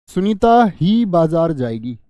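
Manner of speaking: very slow, every word spaced, ही pressed; stress on ही. Shown as a demonstration. Teacher rereads the example, pressing ही slowly. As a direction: drag it out, landing on ही.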